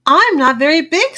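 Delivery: high, loud little-engine voice; little blue engine. A narrator's reading. Little blue engine answers the pleading toys, small engine voice. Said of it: "said the little blue engine".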